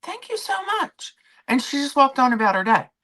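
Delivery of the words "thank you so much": imitating the bartender's much higher thank-you, sugary and soft; high sweet girl voice. Vlogger imitating a sweet bartender thanking her, much higher voice. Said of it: "she's like".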